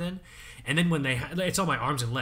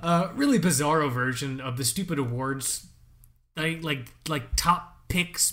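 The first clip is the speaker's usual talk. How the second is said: normal talking voice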